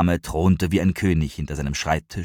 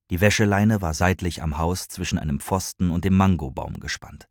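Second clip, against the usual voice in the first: ordinary delivery, nothing marked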